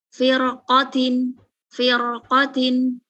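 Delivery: "firqotin" drawn out slowly syllable by syllable; slow demo. Shown as a demonstration. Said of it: "Qafnya fathah". ("firqotin" diucapkan pelan-pelan)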